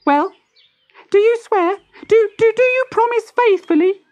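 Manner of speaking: put-on very high, stammering baby tortoise; the baby makes the parents swear, stumbling. A narrator's reading. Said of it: "said the baby".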